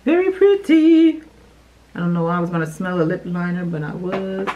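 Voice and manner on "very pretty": excited squeal. Real, not acted. Unboxing, admiring lip liner.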